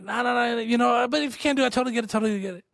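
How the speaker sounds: high, hurried panicked backpedaling; retold freak-out. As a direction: imitate yourself babbling to take it all back. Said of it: "I'm, like, freaking out".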